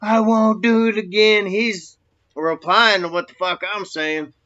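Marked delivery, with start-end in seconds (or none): 0.0-1.4 high, drawn-out pleading cry, imitated; mock scream. Man being whooped begs his attacker, mock screaming. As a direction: mimic a beaten man screaming his apology. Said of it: "I guess he was screaming".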